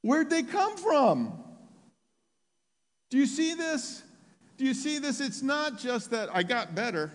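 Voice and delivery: very high, loud, impassioned appeal; full-force preaching. Real, not acted. Preacher asking congregation where the calves came from, full-force preaching. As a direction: hammer the miracle home, voice way up.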